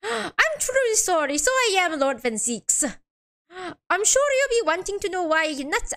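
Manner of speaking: badly faked Irish lilt; poor Irish accent. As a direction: play a defendant with a rough Irish accent. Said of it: "doesn't sound Irish at all".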